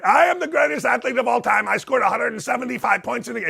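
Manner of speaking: lightly voiced dictator, hammed-up; Kim Jong-un imitation. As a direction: brag like a cartoon dictator, rushed. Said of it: "you just did an imitation".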